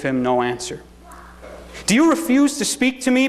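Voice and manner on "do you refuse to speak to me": high-pitched, affronted demand; indignant. A narrator's reading. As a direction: demand an answer from a silent prisoner. Pilate challenges the silent Jesus.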